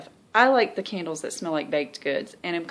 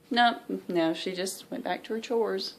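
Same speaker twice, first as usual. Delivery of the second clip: ordinary talk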